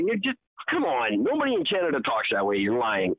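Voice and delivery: ordinary talk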